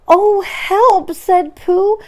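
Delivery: high, loud dismayed cry; mild alarm. Acted. Pooh stuck in the hole, dismayed cry. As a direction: realise you're stuck.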